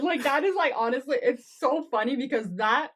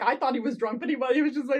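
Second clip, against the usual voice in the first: ordinary talk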